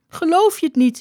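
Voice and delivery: high-pitched, insistent question; insistent woman. A narrator's reading. Aagje to the doubting skipper, high insistent voice. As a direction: pitch it high and press the point.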